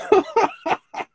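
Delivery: high, loud burst of laughter; real helpless giggling fit; amused laughter. Real, not acted. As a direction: crack up mid-story. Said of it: "Excuse me, it's very funny".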